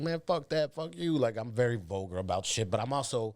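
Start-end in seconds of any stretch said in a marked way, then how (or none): 0.0-1.2 higher, brash street talk. Retold or imitated. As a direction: toss it off rough and vulgar.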